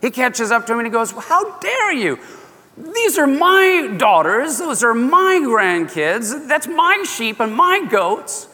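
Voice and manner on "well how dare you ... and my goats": high, slow, indignant scolding; angry father-in-law. Retold or imitated. Laban confronting Jacob about his daughters, slow and indignant. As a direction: lay into him, outraged. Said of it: "he's so angry".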